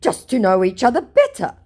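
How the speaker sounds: high, loud put-on reply; hammed-up innocent answer; scammer voice. Scammer explains why he messaged her, high hammed-up voice. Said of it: "he goes".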